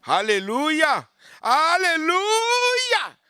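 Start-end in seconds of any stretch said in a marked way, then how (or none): 1.4-3.2 high, drawn-out shouted alleluia; preacher crying out to the congregation. Real, not acted. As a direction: stretch it into a long high cry.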